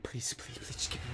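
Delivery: hushed, breath-only begging; barely audible begging under the breath; whispered pleading. Real, not acted. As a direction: whisper a desperate plea.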